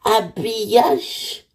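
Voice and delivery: put-on a toddler drawing out a new word; little boy voice. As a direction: enfant qui demande lentement. Child repeats word, asking.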